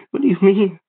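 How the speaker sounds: panicked. A narrator's reading. Boy panicking at the stranger's words, panicked. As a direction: blurt it out in panic. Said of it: "I asked, panicked".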